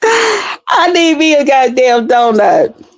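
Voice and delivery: slow, high, laughing plea for a donut; laughing craving. Real, not acted. Driver laughing to viewers, craving a donut.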